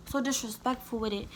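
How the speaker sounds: ordinary delivery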